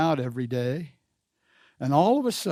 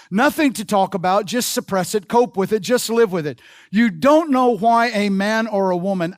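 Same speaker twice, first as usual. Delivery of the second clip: loud, raised sermon voice at full force; impassioned preaching; 5 semitones higher, 7 dB louder than usual. Real, not acted. Preacher urging congregation not to judge others, impassioned. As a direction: drive it home to the congregation, loud and high.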